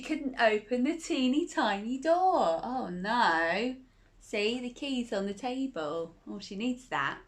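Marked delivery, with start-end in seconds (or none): none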